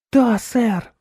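put-on high, loud eager boy; delighted. A narrator's reading. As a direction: answer with beaming enthusiasm. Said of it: "восторженно просиял Мортон". (восторженно, по-мальчишески)

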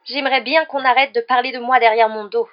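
loud, high wailing complaint; tearful outburst. A narrator's reading. Mimi feels talked about behind her back, tearful outburst. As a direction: protest as if close to sobbing. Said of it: "s'exclama Mimi d'une voix sanglotante".

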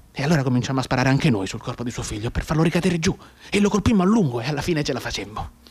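usual speaking voice

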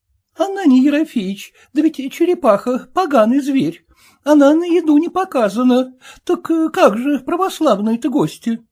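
high pleading wife's voice; timid merchant's wife pleading, voiced high. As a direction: beg the husband to let her listen, pitched high. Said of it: "Снова вернула слово купчиха".

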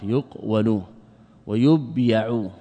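"يقول ويبيع" slowly as yuq-walu wa-yub-ya'u; vowelling demonstration. Shown as a demonstration. Teacher dictating the vowels, "يقول ويبيع" split slowly into yuq-walu, yub-ya'u. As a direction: break "يقول ويبيع" after the q and the b. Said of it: "الواو وإسكان القاف".

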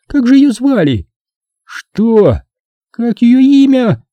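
high-pitched jealous demand; squealing. A narrator's reading. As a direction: demand the name in a feminine squeal. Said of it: "взвизгнула девушка". (визгливо, требовательно)

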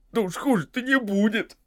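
high, plaintive protest; whimpering objection; tearful retort. A narrator's reading. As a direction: answer back still upset. Sam tearfully arguing back.

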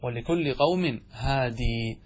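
slow recitation with a long ee on هادي; Ibn Kathir reading. Shown as a demonstration. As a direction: hold the final ya, هادي as "haadii". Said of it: "هذه قراءة ابن كثير المكي".

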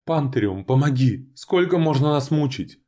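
high, loud desperate shout; desperate cry. A narrator's reading. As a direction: cry out into the void in despair. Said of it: "прокричала Кореналия ... в полном отчаянии".

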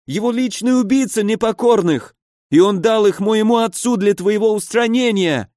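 high-pitched, pained wailing; panicked injured baron. A narrator's reading. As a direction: plead in high, agonised bewilderment. (высокий страдальческий голос перепуганного барона)

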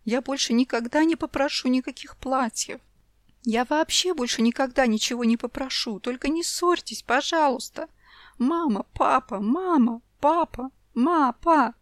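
put-on child begging parents not to fight; sobbing child. A narrator's reading. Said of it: "всхлипывая". (девочка всхлипывает, умоляя)

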